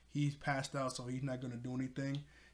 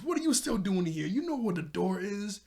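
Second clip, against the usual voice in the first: higher, louder mock annoyance; acted irritation; 6 semitones higher, 6 dB louder than usual. Bond ordering Ren out during a quarrel, acted irritation. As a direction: tell him off in an animated retelling.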